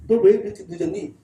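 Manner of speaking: usual speaking voice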